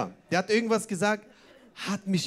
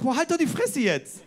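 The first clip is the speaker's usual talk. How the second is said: mock self-scolding; 5 semitones higher, 3 dB louder than usual. Snapping at himself in thought.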